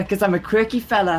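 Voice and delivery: mock Cockney quirky fella; faux Cockney. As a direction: ham up a Cockney accent. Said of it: "your faux Cockney accent".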